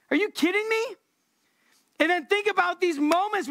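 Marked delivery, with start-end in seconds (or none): 0.1-1.0 incredulous. Pretend. Zechariah's incredulous reaction.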